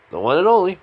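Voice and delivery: high, loud, proud announcement; showy self-introduction. Acted. Tony reveals himself to his old friend, high and showy.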